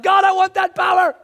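loud, high, hungry cry; mock-eager shout for power; a hammed-up wish shouted out. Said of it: "I'm like".

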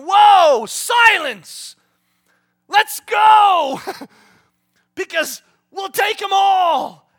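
rally the troops at the top of your voice; Caleb rallying shout. Acted. Caleb urging the people into battle, rallying shout. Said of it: "That's Caleb going".

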